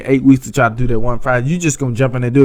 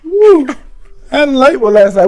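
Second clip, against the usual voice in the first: high, louder mock girlfriend chatter; a hammed-up gossipy wife voice; imitating sleepy wife; 11 semitones higher, 4 dB louder than usual. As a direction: play her making excuses for her nap. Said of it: "You still gonna be like".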